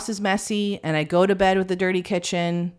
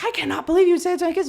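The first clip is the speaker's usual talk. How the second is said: fast, high-pitched disbelief; mock shocked critic; 13 semitones higher, 4 dB louder, much faster than usual. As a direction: imitate a scandalized parent, rushed and high. Imitates scandalized critics.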